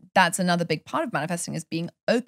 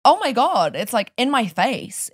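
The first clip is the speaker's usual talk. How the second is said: higher, louder, slow amazed; excited realization; 6 semitones higher, 4 dB louder, slower than usual. Retold or imitated. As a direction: savour the discovery. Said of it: "And then you're like".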